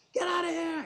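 high, loud yell to chase him off; retold scream at a truck thief. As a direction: shout "Get out of here!" at the thief. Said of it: "I scream at him".

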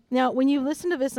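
ordinary talk, nothing marked

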